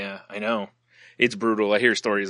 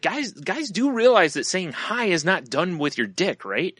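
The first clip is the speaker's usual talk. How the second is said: higher, slow scolding; sarcastic lecture; 8 semitones higher, slower than usual. Retold or imitated. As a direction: talk down like to fools. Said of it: "I was like".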